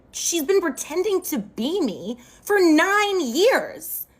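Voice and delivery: slow, high-pitched indignant emphasis; violated, indignant. Real, not acted. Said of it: "I feel violated".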